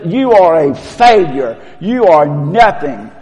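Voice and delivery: loud, accusing Satan; a touch of taunting devil; Satan's accusing voice. Retold or imitated. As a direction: hurl the words down as the accuser. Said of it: "Today when Satan says".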